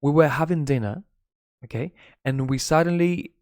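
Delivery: normal talking voice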